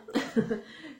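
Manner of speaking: short amused laugh. Real, not acted. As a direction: laugh briefly at your own slip. Rit de sa démonstration ratée. (petit rire amusé)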